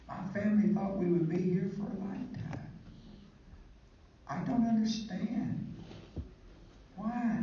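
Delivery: a voted-out pastor begging, half-tearful; pleading through tears. Retold or imitated. Voted-out young pastor pleading with his congregation, mock tearful. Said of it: "He's crying".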